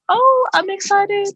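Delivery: high, drawn-out excited reaction; high-pitched, stretched-out excited quote. Retold or imitated. Retelling own reaction to rumors.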